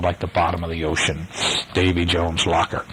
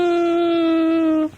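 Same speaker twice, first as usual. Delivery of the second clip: very high rooster crow. A narrator's reading. Narrator voicing the eerie call from cabin, crowing. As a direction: crow long and high like a cockerel. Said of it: "then was heard a crowing sound".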